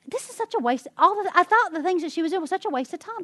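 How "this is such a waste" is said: mock scornful high voice, imitating her younger self; a put-on grumpy complaint; dismissive grumble. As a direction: sniff at it like a waste of time. Retelling her own past grumbling.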